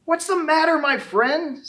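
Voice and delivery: high, thin little squeak; small high worried squeak; squeaky voice. A narrator's reading. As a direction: squeak it out like a tiny tailor. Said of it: "squeaked a voice from the lane".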